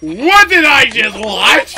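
loud, slow disbelief; stunned disbelief. Real, not acted. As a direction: drag it out in shock. Reacting to a bizarre cutscene.

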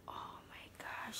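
breathless little whisper, rising at the end; awed whisper. Real, not acted. Showing her new baby doll to viewers, awed whisper. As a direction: whisper it like you can't believe her.

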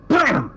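loud, high spoken gunshot; shotgun bam. Acted. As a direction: bang it out like a shotgun. GM describes a second shotgun blast.

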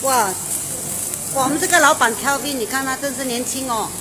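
ordinary talk, nothing marked

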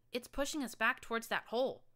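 high, loud warning; alarmed shout. Acted. Narrator shouts to Glory while pushed back, high and loud. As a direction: call it out as you're driven back.

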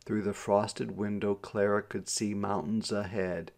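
ordinary delivery

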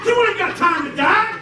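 high-pitched protesting disciple; Peter. Acted. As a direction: talk him out of it in a high voice. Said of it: "that's how Peter was acting".

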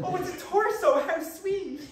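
high, gushing fake sweetness; mock delight. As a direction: coo over it as if it's adorable. Gushing over a torso pic.

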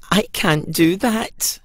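a touch of sadness, slow; Pumpkin's sad voice. A narrator's reading. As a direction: say it with a sinking heart. Pumpkin refuses again.

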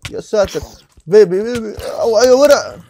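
high, loud panicked shouts; frantic yelling. Real, not acted. Gamer swarmed by mobs, shouting frantically. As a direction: yell over the fight in a panic.

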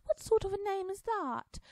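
high, loud incredulous question. A narrator's reading. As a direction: sound baffled. Alice amazed at name.

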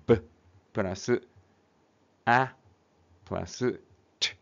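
ブ, ア, ト as isolated short phonics sounds; ブ plus ア plus ト, each sounded alone; separate letter sounds. Shown as a demonstration. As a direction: say ブ, ア and ト apart, with pauses. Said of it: "これ、読み方だな".

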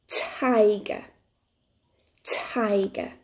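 "Tiger" drawn out slowly for learners; nice and slowly. Shown as a demonstration. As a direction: stretch "Tiger" out, first sound distinct. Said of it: "nice and slowly".

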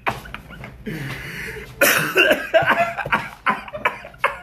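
wheezing, unvoiced laugh up high; high breathless laugh. Real, not acted. Laughing at his own joke, breathless wheeze. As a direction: let a silent wheeze of a laugh out.

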